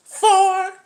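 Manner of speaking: high, sassy woman impression. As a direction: toss it high and careless. Woman voiced in dialogue.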